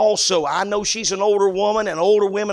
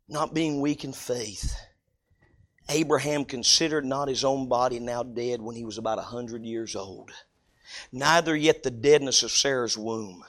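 scripture reading; 9 semitones lower, 4 dB quieter than usual. Acted. Preacher to congregation, solemn low scripture reading. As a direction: read it like holy writ. Reads Romans aloud.

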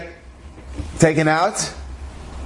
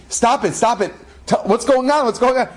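higher, urgent retold pleading; acted agitation; 7 semitones higher than usual. Rav trying to calm the screaming boy, acted agitation. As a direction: plead in mock exasperation.

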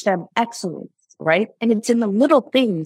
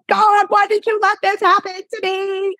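high, pleading cry to God, much higher; acting out her despairing plea, voice lifted high; 14 semitones higher, 3 dB louder than usual. A woman, retelling her tears, cries out to God in high despair. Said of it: "part of my crying is like".

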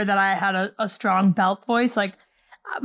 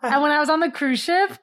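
ordinary talk

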